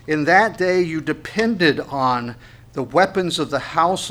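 slow, emphatic Bible reading; scripture reading. Acted. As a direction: read the verse out slowly with weighty stresses. Reads scripture aloud to congregation.

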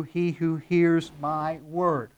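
slow, spaced-out reading that lands on "word"; stress on WORD. Shown as a demonstration. Preacher reading verse twenty-four to congregation, slow emphasis on "word". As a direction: set "my word" apart, word by word. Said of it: "underline the word word, because it's a great emphasis there".

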